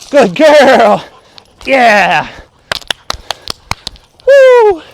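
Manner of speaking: excited dog praise. Real, not acted.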